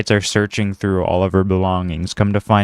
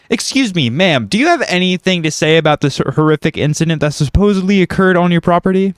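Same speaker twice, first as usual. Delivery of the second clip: news reporter; 7 semitones higher than usual. Acted. Reporter Martha questions Rachel live on scene, high and punchy. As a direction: pitch it up like a breathless live field reporter. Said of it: "I'll play Martha, the news reporter".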